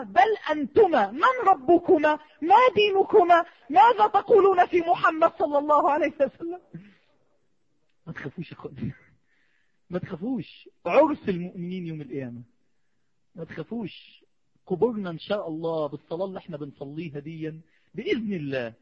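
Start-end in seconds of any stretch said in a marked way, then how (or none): 0.0-6.6 loud, high, drawn-out counter-questioning; forceful challenge. Acted. Omar turning the grave angels' questions on them, forceful challenge. 8.1-14.2 gentle reassurance. Real, not acted. Preacher telling his brothers not to fear, gentle reassurance.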